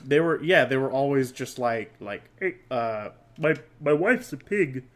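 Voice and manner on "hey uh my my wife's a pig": a hint of Kermit the Frog's voice; Kermit impression. S0 shows his old Kermit attempt, lightly voiced. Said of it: "attempt impersonations of Kermit the Frog".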